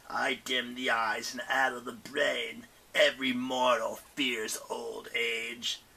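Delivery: slow, eerie crone; old age spirit. Acted. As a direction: creep through it slowly. Garrus voiced.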